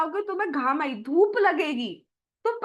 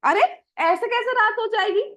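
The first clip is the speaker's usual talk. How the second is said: put-on higher, louder little boy protesting; a child surprised at mother's worry; child Krishna voice; 5 semitones higher, 5 dB louder than usual. Little Krishna protests to mother, higher and louder. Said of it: "तो भगवान कहते हैं".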